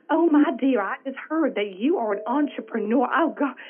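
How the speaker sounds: high, loud, alarmed Southern mom. Retold or imitated. Mom phoning her entrepreneur daughter, freaking out in Southern accent. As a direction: imitate a Southern mother freaking out. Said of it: "in her Southern voice".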